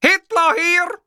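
very high, loud yell; screaming. A narrator's reading. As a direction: scream it into the phone. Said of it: "schrie ich".

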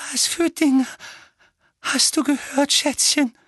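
high, anxious mother voice with halting pauses; hauchend, den Tränen nahe. A narrator's reading. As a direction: ask your darling fearfully, pitched high. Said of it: "hauchte Tante Petunia". (hohe, bange, gehauchte Frage)

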